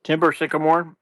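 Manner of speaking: usual speaking voice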